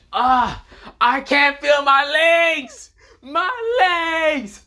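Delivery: loud, high, drawn-out cry; hammed-up howl of an injured man; agonized wail. Deadpool cut in half, wailing.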